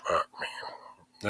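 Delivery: breathy whispered disbelief; whispered curse. Retold or imitated. Exasperated at the world's news, whispering the curse. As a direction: whisper it under your breath. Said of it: "turn around and you go".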